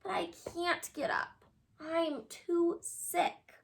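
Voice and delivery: soft, slow, sickly moan; sick Wilbur moaning to his friends. A narrator's reading. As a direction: moan weakly from bed. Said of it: "moaned Wilbur".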